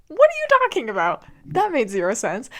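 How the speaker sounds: high, loud, disbelieving yell; outraged shriek of disbelief; incredulous shout. Real, not acted. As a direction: shout it like the plot insulted you.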